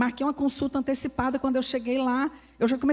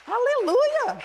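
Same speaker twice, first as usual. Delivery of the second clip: aleluia flung very high with a falling end; soaring hallelujah; 12 semitones higher, 3 dB louder, much slower than usual. Real, not acted. Preacher crying out praise, very high and ecstatic. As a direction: lift it way up, then let it fall.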